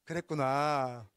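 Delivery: "그랬구나" drawn out very slowly, falling; unhurried, settling repeat; slow calm acknowledgment. Shown as a demonstration. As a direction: stretch "그랬구나" out soothingly. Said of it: "자".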